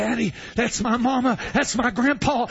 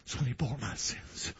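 much lower and softer; hushed reverent sermon voice; 12 semitones lower, 12 dB quieter than usual. Real, not acted. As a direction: drop to a near whisper.

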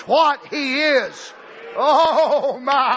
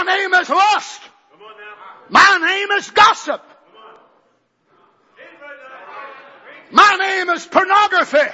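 shouted confession; 3 semitones higher, 3 dB louder than usual. Acted. Congregation voiced confessing sins.

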